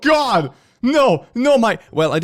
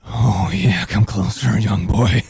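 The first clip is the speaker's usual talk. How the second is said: creepy recruiter; 3 semitones lower, 5 dB louder, slower than usual. Acted.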